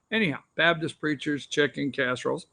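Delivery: ordinary talk, nothing marked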